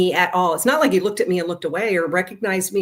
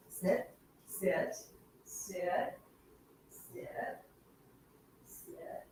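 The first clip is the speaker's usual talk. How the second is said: firm sit fading to a whisper; dog command fading; 16 dB quieter, much slower than usual. Real, not acted. Owner telling the dog to sit, softer each time. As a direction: let each sit get softer till breathless.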